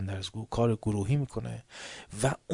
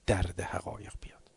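hushed whispered warning; 3 semitones lower, 3 dB quieter than usual. Acted. Preacher whispering the lesson, hushed. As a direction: whisper it close.

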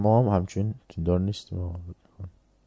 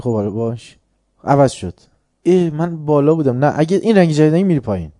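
mock quarrel; 5 semitones higher, 8 dB louder than usual. Voiced exchange about fashion.

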